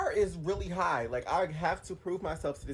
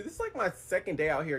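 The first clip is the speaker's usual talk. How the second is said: ordinary talk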